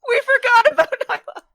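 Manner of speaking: high, loud wail of dismay; dismayed cry. Real, not acted. Player realising the party forgot a falling friend, dismayed cry.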